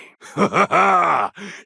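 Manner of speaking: loud, high rising laugh; a big exultant warrior laugh; hearty booming laugh. Acted. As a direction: laugh out with glee.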